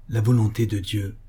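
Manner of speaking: ordinary delivery, nothing marked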